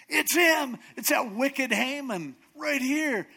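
high, shouted denunciation; loud accusation. Retold or imitated. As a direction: point him out at full voice. Esther denounces Haman to king.

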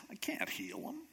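breathy, whispered helpless whimper; mock whining and crying. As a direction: whisper it as a despairing king, acted. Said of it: "like, whining and crying and mourning".

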